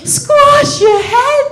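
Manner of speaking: high, slow, loud incredulous repeat; shocked disbelief. Retold or imitated. Herself repeating the threat in high shocked disbelief. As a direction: shriek it back in horror. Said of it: "And I'm like".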